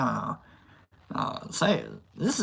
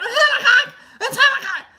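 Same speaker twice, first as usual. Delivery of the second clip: much higher, louder, laughing shriek; laughing scream; 6 dB louder than usual. Retold or imitated. Vlogger retells screaming at the movie, laughing and high. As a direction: shriek the name through laughter. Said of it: "I was like".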